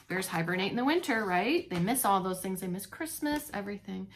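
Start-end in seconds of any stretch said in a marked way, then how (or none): none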